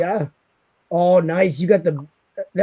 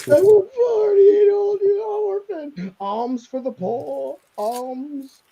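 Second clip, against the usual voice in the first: pitiful begging orphan; 11 semitones higher, 4 dB louder, slower than usual. Pretend.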